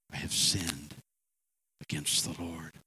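low, subdued admission of guilt; humble confession. Acted. David confessing to Nathan, low and contrite.

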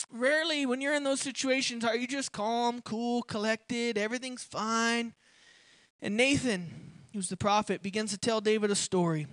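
ordinary talk, nothing marked

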